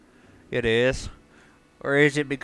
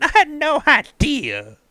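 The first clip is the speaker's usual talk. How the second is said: very high, loud, drawn-out squeal of surprise; hammed-up astonished shriek; mock amazement; 8 dB louder, slower than usual. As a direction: exclaim it hugely, stretching the words. Mock amazement at game tip.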